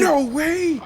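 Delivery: high loud disbelief; surprised shout. Real, not acted. Reacting to friend's death.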